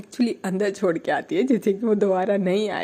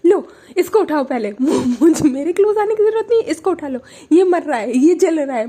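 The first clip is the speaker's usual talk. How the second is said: high, loud, fast scolding; loud high jealous scolding; angry shout; 8 semitones higher, 8 dB louder than usual. Retold or imitated. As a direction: shriek at her to pick the dog up. Angry girlfriend scolding over dog.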